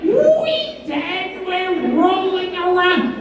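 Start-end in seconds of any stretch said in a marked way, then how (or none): 0.0-0.9 comic high-pitched outburst; mock yell. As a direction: yelp it way up high, gleefully silly. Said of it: "and I yell".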